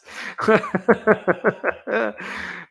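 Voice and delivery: long, high, breathless laughing fit; real helpless laugh. Real, not acted. Streamer cracking up at a viewer's joke, helpless laugh. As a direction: keep laughing in high bursts.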